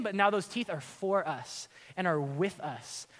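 ordinary delivery

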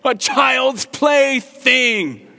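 very high, very loud, slow cartoon shout; shrieking cowboy toy yell; Woody yelling. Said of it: "Woody yells out".

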